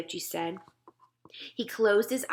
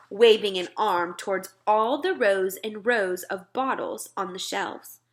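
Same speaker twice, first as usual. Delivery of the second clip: plain reading voice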